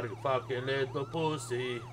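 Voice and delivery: normal talking voice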